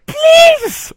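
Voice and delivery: very high, loud mock shriek; imitated scream. Host imitating the trailer's screaming woman, piercing high shriek. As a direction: shriek it out like a horror-film victim. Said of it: "skriker in i kameran".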